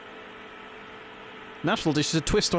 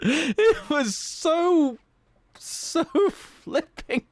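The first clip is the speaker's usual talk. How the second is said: very high, drawn-out words; stretched high-pitched outburst; worked-up squeal; 12 semitones higher, much slower than usual. Real, not acted. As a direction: squeal it slowly, overcome. Worked up, later says calm now.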